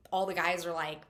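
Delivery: usual speaking voice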